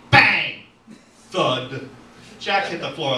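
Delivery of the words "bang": loud, high gunshot effect; shouted bang. A narrator's reading. Narrator imitating a pistol shot, shouted bang. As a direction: fire it out sharply.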